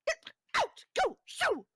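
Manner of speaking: high, loud bursts of command; shrieking. Acted. Hostess pointing intruders to the door, shrieking. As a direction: shoo them out with separate shrieks. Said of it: "hearing the shrieking".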